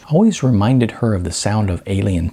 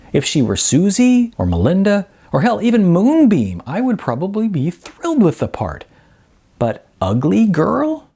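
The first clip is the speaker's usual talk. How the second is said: raised, indignant complaint; 8 semitones higher than usual. Acted. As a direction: vent with rising frustration. Heated complaint about the role.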